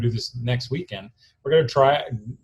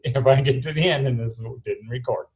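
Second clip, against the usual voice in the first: ordinary talk, nothing marked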